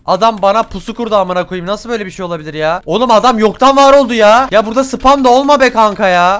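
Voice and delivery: loud, high-pitched outraged rant; angry loud complaint. Real, not acted. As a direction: vent about the ambush loudly. Complaining about an ambush. (sinirle yüksek sesle söylenerek)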